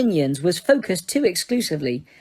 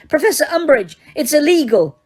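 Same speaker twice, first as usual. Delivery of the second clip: high, loud desperate protest; pleading girl; 8 semitones higher, 4 dB louder than usual. Acted. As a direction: beg her to stop.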